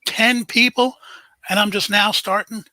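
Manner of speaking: real surprise, people punched; surprised. Real, not acted. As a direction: react to a crowd that beat you there. Surprised by crowd before start.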